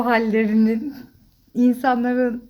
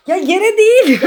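high, loud and laughing; laughing protest; 10 semitones higher, 5 dB louder than usual. Real, not acted. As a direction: laugh while scolding. Mother laughs at the miss. (gülerek itiraz)

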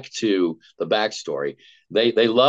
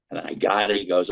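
normal talking voice

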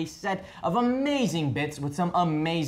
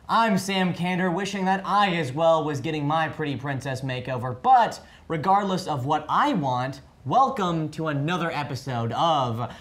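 bright hammed-up host welcome; cheesy co-host intro; talk show host; 2 semitones higher, 3 dB louder than usual.

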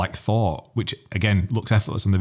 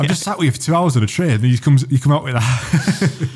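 amazed; 7 semitones higher, 5 dB louder than usual. Real, not acted. Amazed at friend's opening line.

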